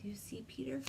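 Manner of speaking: soft unvoiced whisper; hushed aside to the listener. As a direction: whisper it like a secret. Aside whisper during story.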